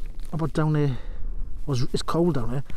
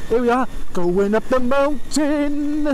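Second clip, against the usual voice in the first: laughing; 3 semitones higher, much slower than usual. Real, not acted. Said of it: "I don't know what I'm laughing for".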